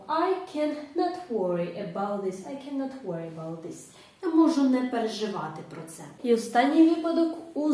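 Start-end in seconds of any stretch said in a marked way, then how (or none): 2.4-3.9 softer repeat. Shown as a demonstration. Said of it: "то ми скажемо".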